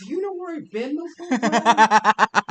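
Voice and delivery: high, loud and slow eerie question; creepy stranger. Acted. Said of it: "He's like".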